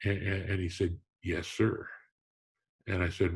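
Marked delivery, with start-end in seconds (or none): 1.2-1.9 an imitated Mississippi drawl, a little softer; Ray Guy's Mississippi drawl. Punter hearing the Hall of Fame president again, soft Mississippi drawl. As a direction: ease out a stunned Mississippi yes sir. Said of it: "in his slow, uh, Southern Mississippi drawl".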